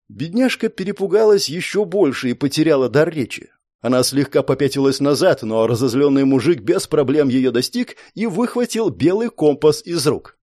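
ordinary delivery, nothing marked

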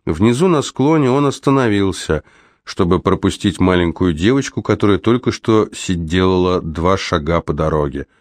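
usual speaking voice

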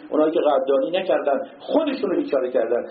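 ordinary delivery

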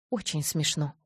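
plain reading voice

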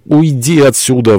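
high, loud, drawn-out command; sudden outburst. Acted. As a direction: burst out suddenly to send him away. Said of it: "внезапно произнесла госпожа Дореналь".

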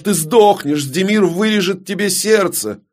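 high-pitched furious shriek; shrieked threat. Acted. Далина threatens her captor, shrieking. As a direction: squeal the threat, pitched right up. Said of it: "завизжала Далина".

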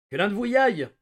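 panicked shouted command; shouted order. A narrator's reading. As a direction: bark the order at the room. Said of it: "cria le psychiatre".

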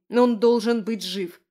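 plain reading voice

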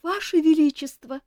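a high, frightened cry of address; frightened girl addressing the king; trembling plea. Acted. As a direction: she can barely get the words out, lips trembling. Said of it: "губы у неё дрожали".

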